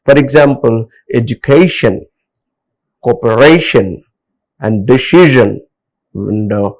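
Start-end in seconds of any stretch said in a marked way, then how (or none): 1.1-4.0 "education, cooperation" with a strong "-ca-" and "-ra-"; stress on second-last syllable. Shown as a demonstration. Teacher giving suffix examples, "education, cooperation" stressed before the ending. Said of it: "second syllable to be stressed". 4.9-5.6 "decision" with a strong "-ci-"; stress on second-last syllable. Shown as a demonstration. Teacher giving a suffix example, "decision" stressed before the ending. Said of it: "second syllable to be stressed".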